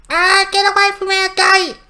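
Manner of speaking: very high, loud squeaky imp screech; shrieking imp. Acted. Imp screeching at Harmug to get away from the gate, squeaky and loud. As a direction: screech it like a tiny furious goblin.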